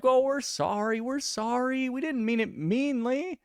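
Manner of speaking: high, mocking sorry voice; apologetic women. Imitating drunk women apologizing, high mocking voice. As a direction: mimic them backpedaling. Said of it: "they were like".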